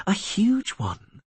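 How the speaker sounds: high, slow, drawn-out reply; slow emphatic. A narrator's reading. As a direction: stretch it out with delight. Yennefer's emphatic answer.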